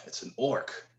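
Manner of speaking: put-on older boy scowls at the orc and grumbles; scowling mutter. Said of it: "scowls at you and says".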